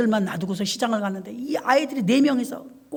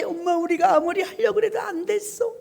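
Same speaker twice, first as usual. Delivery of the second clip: put-on higher, little-kid voice; child's voice; 8 semitones higher than usual. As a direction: sound like a small child explaining to mom. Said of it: "자기들이 하려고 해". (아이가 엄마에게 하소연하는 어린아이 목소리)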